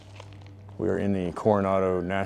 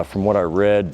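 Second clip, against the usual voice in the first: ordinary talk, nothing marked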